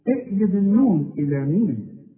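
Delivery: usual speaking voice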